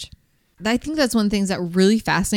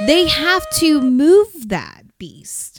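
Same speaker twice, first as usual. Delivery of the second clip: excited; 7 semitones higher, 4 dB louder, slower than usual. Real, not acted. Describing the huge sluice.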